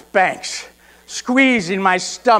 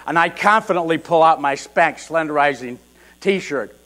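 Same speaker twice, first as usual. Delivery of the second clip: normal talking voice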